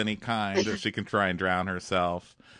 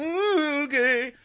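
squeaky little okay; high meek reply; slower than usual. Retold or imitated. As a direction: agree in a tiny high voice. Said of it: "She's like".

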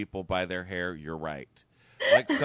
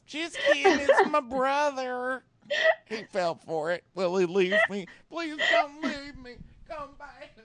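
clingy Raven; 13 semitones higher than usual. Retold or imitated. Raven begging him not to leave, hammed-up high voice. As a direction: squeal and plead like a needy girlfriend.

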